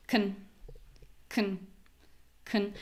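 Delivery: "Con" reduced to a short, soft k'n; low unstressed syllable. Shown as a demonstration. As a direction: say "Con" with almost no vowel. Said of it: "So my voice is low".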